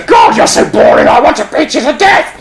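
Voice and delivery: harsh, high puppet outburst; hammed-up murderous exasperation; exasperated Punch. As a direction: squawk it in mock fury. Punch's outburst at irritating Joey.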